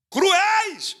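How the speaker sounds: high, loud alarmed call; panicked warning. Retold or imitated. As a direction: warn him in a rush of fear. Fleeing people warn Samar.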